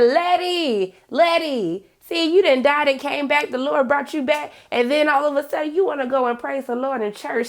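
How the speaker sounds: high, loud church auntie scolding Letty; sassy church lady. Retold or imitated. Church lady teasing Letty at service, loud and high.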